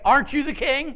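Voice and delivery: incredulous challenge. Acted. Jezebel mocks Ahab pouting in bed, high and scornful. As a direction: needle him with an indignant question. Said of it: "She's like".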